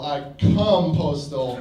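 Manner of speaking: slow punchline, a hint of smirk. Comic to audience, slow punchline delivery. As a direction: drag the punchline out. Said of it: "I don't go postal".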